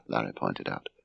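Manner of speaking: usual speaking voice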